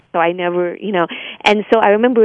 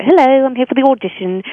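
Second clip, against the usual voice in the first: put-on British accent, pitched up; 6 semitones higher than usual. American actor arriving at an audition, put-on British. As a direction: cheerily pose as a Brit, higher voice. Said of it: "when I do the auditions for that".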